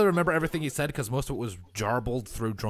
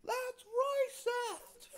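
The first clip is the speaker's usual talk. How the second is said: put-on high, slow kid's squeal; little kid screaming; 10 dB quieter, much slower than usual. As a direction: squeal it like a tiny outraged child. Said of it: "from a little kid".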